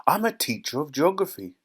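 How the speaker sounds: lightly voiced indignant lady; indignant cry. A narrator's reading. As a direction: protest it with offended authority. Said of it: "cried Missus Salt".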